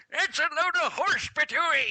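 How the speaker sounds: put-on high, loud cranky old prospector. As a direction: squawk it like a grizzled old-timer. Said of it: "a ninety-year-old prospector".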